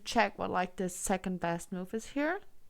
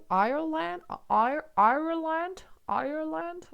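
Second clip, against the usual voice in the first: unsure attempts at an Irish accent, pitched higher and rising; trying an Irish accent; 8 semitones higher, 4 dB louder than usual. Shown as a demonstration. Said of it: "How would you say it?".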